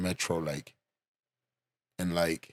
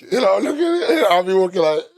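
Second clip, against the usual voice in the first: laughing; 11 semitones higher, 7 dB louder than usual. Retold or imitated. Acting himself out, amused and laughing.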